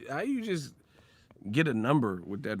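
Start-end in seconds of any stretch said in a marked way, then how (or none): none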